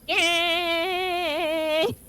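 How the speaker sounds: long, high-pitched yay; drawn-out victory squeal; celebratory cheer. Real, not acted. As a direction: hold out a high, gleeful cheer. Celebrating finishing the long task.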